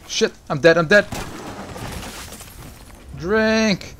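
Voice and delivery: frantic yell. Real, not acted. Streamer getting killed, shouting in frantic dismay. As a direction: yell it as everything collapses. Dying in game.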